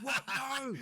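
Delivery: high, loud indignant no; appalled rejection; disgusted outcry; outraged refusal. Retold or imitated. Lady refuses to eat.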